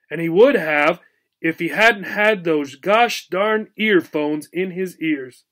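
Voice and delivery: usual speaking voice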